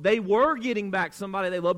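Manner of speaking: emphatic "were", brisk remainder; stress on WERE. Real, not acted. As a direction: lean hard on "were" as a concession, then move briskly through. Concedes the point just denied.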